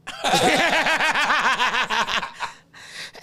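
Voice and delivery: high, rolling cackle; long real belly laugh; big laugh. Real, not acted. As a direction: crack up in a long, helpless laugh. Cracks up at friend's remark.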